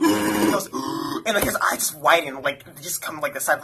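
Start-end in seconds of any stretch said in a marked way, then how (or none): 0.7-1.2 imitated sharp deep inhale; mimicking a friend's gasp; deep excited gasp. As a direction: draw in a big, excited breath. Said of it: "inhales deeply".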